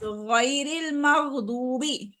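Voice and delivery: गैरिल मग़दूबी drawn out very slowly with a steady ग़; slow model reading. Shown as a demonstration. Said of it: "teacher models again". (बहुत धीमे, साफ़ गैरिल मग़दूबी)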